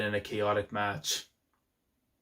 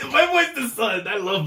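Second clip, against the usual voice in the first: high-pitched, louder fan squeal; excited gushing; 10 semitones higher, 5 dB louder than usual. Real, not acted. Fan gushing over a favourite character, squealed excitement. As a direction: gush in a high, loud voice.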